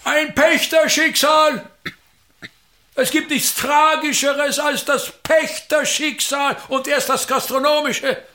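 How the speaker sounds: high, declaiming stage pathos; mock tragic pathos. Actor pities the innkeeper's tenant fate, hammed-up tragic declaiming. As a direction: lament it like a tragedian on stage.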